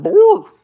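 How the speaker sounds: one very high, loud bark; imitated dog bark. Mimicking a neighborhood dog, loud high bark. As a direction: yap it out like a dog. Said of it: "or a dog going".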